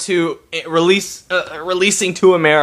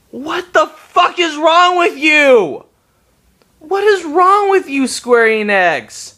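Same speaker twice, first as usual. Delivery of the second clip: higher, louder furious yell; angry outburst; 10 semitones higher, 3 dB louder, slower than usual. Real, not acted. Fan yells at Square Enix over the collector's edition, furious and loud. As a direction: blow up at them in real rage. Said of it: "I'm angry".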